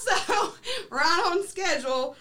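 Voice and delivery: high laugh carrying into the words; real laughter. Real, not acted. Laughing as the story reaches the plane drop.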